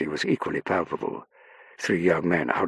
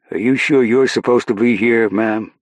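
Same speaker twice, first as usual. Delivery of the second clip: loud, raised-pitch sergeant's query; challenging sergeant; 6 semitones higher, 9 dB louder than usual. Acted. Sergeant doubting Saffron should be aboard, challenging her loudly. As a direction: question her right to be here, loudly.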